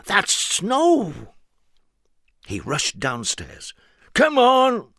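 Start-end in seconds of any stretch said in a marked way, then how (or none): 0.1-1.3 high, eager silly character; high excited voice. Acted. Mister Silly urging Mister Nonsense, high and eager. 4.1-4.9 high, loud call. Acted. Mister Silly calling his friend, high loud call. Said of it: "he called".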